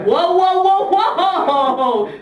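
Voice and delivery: high, loud mock shocked whoas; shocked passengers. Passengers realising the caller is cheating, loud shocked whoas. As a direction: gasp it out like a scandalised bus crowd. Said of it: "Everyone's like".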